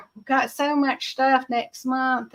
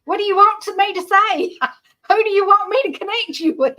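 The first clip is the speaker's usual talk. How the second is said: higher, laughing through the question, a bit fast; laughing puzzled reply; 8 semitones higher, 5 dB louder, faster than usual. Retold or imitated. Switchboard girl asks callers who they want, higher and laughing. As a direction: play her younger self laughing and baffled.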